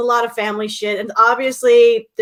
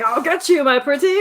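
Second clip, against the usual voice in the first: Wicked Witch; 5 semitones higher than usual. Retold or imitated. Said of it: "like the Wizard of Oz".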